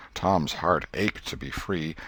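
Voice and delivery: usual speaking voice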